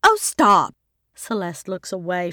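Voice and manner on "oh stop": loud exasperated oh stop; annoyed loud brush-off; protest. A narrator's reading. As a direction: shut down the teasing. Sister teases her about psychic gifts.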